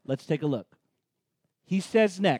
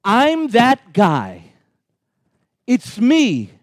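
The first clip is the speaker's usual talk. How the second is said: bold Jesus claim; 10 dB louder, much slower than usual. Acted. Preacher voices Jesus' claim.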